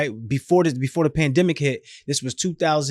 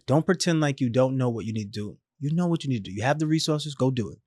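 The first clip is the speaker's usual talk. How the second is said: soft, calm and quieter; calm mother; 5 dB quieter than usual. Retold or imitated. Dying mother on the phone calms her panicking son, soft and calm. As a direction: settle him down gently, a steady mother's voice. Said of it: "she's calm as heck over there".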